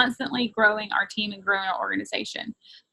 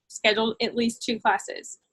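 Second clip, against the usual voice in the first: normal talking voice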